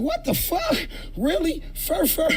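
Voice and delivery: high-pitched disbelief; incredulous. Real, not acted. Reacting to video.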